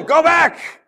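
high, loud shouted order; hammed-up heavenly yell; mock angel shout. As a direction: shout it at the sleeping man. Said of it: "and tell him".

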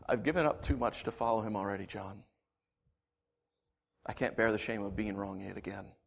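low, soft confession; weary shame. Acted. Thomas confiding in John, soft low shame. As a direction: let it out quietly, worn down.

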